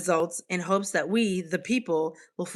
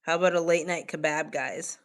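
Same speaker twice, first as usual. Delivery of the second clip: normal talking voice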